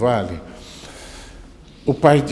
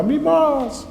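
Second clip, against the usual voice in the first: very high call echoing back; shouted echo; slower than usual. Acted. Valley answers the boy's call, echoing shout. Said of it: "lá no fundo do vale gritava".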